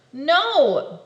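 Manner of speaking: loud, fed-up refusal; exasperated no. Acted. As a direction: shut down the nagging. Exasperated answer.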